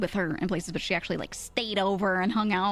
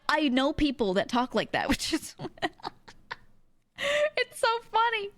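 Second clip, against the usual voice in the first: ordinary talk, nothing marked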